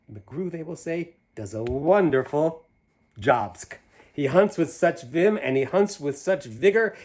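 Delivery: lively rhyming storybook read; reading to children. A narrator's reading. Reading a picture book aloud to children, lively. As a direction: bounce through the rhyme for the kids. Reads Seuss rhyme aloud.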